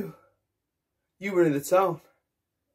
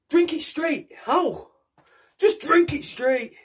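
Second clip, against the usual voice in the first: pushy mate; 9 semitones higher, slower than usual. Acted. Pals pushing vodka on him.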